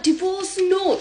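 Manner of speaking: high-pitched incredulous refusal; acted disbelief. Wife refusing husband's divorce demand, high and disbelieving. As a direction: balk at the very idea in a high voice.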